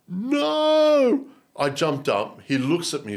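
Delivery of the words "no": high, loud cry of no; a sudden high, loud shout; shocked shout. Retold or imitated. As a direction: burst out in shock.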